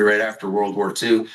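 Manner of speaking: ordinary delivery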